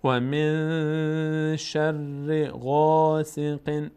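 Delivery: و من شر غاسق chanted slowly; tajweed chant; slow recitation. Shown as a demonstration. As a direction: draw out each syllable. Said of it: "recitation example".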